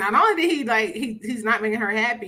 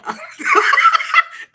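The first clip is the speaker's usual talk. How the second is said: laugh; 7 dB louder than usual. Real, not acted. Laughing at own point.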